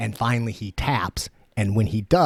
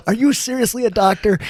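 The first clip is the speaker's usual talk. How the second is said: high, loud disbelief, retold; incredulous; 13 semitones higher, 6 dB louder than usual. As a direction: act out exasperated disbelief at the doctor, pitched way up. Acting his disbelief at useless doctor.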